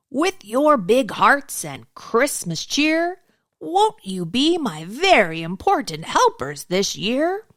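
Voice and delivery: jolly Santa voice. Acted. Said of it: "he said".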